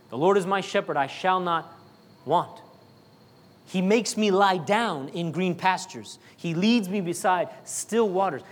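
slow, reverent reading of the psalm; scripture recitation. Acted. Preacher recites Psalm twenty-three to the congregation, slow and reverent. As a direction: let each line settle slowly.

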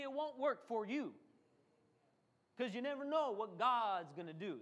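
usual speaking voice

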